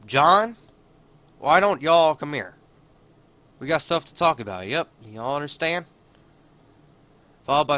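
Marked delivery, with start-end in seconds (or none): 0.1-5.8 put-on hillbilly accent, higher and louder; hammed-up hillbilly accent, calling him over. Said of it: "odd hillbilly accent".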